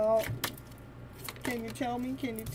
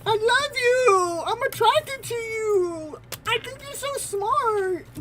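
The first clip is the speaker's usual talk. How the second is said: high lovestruck voice; 8 semitones higher, 4 dB louder than usual. Acted. Repeating TM's messages in voice.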